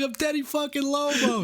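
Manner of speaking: high, strained distant yell; Teddy Lobo. Retold or imitated. Crook yelling at checkpoint cops from his car, strained yell. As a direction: imitate someone hollering from far off in a car. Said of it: "really far in the distance in his car going".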